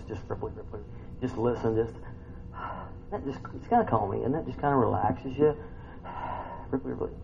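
soft, hushed soothing words; calm, soft. Shown as a demonstration. Preacher describing rippling water to listeners, soft and soothing. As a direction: ease them into calm.

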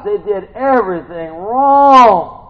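high and loud, drawn out slowly; hammed-up preacher's disbelief; exasperated shout. Real, not acted. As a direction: stretch WRONG into a long loud wail. Exasperated repeat of phrase.

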